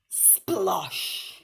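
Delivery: drawn-out, explosive splosh; storybook sound-effect word; big splash noise. A narrator's reading. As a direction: say it like the water bursting up. Thieves fall into the pond.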